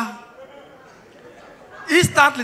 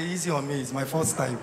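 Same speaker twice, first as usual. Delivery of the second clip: soft, much lower, shy little plea; mock shy plea; 9 semitones lower, 12 dB quieter than usual. Girl at the salon pleading softly, mock shy. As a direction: play it coy and sheepish. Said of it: "the class say".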